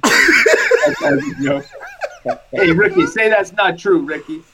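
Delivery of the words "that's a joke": high, slow laughter through the words; laughing. Real, not acted. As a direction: laugh it out, amused. Laughing at a joke.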